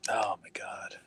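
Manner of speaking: whispered under the breath in disbelief; a real aghast whisper to himself; stunned whisper. Real, not acted. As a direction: breathe it out, unvoiced, shocked. Stunned reaction to the clip.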